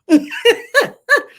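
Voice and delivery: sudden high loud laugh; high burst of laughter. Real, not acted. Laughing at her own joke to viewers, loud high laugh.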